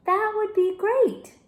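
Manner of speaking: high, slow, overly bright; forced cheer. A narrator's reading. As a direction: fake cheerfulness to hide fear. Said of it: "trying to be cheery".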